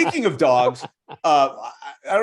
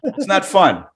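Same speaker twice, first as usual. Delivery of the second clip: loud, scolding dog confronting its owner; indignant dog; 3 semitones higher, 6 dB louder than usual. Acted. Dog scolds owner who took him to dog prison, loud and indignant. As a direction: chew the owner out as the betrayed dog. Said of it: "He's gonna be like".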